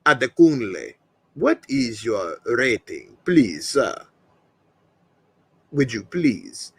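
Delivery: a lightly done John Wick scene voice; John Wick impression. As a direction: speak like the Continental manager to Wick. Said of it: "That's my John Wick, uh, impersonation".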